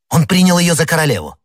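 high, astonished exclamation; sudden excited realization; excited deduction. A narrator's reading. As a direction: blurt out the discovery, pitched well up. D'Artagnan blurts out his startling deduction.

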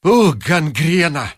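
slow, spat-out janitor's curse; a slow hateful growl of an angry janitor. Acted. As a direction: drag out the insult with hatred. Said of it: "с ненавистью сказал".

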